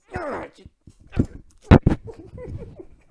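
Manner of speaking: big high burst of laughter; loud laugh. Real, not acted. Reviewer cracking up at his own rant, loud laugh. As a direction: let a loud laugh explode out.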